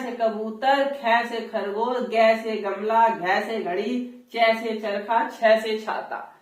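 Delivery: Haryanvi style, "चै" with an open ai vowel; slow Haryanvi recital, each letter as "खे", "गै", "चै"; Haryanvi pronunciation. Retold or imitated. Teacher imitating how Haryana pupils recite the alphabet. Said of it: "वहां लोग पढ़ते हैं कै".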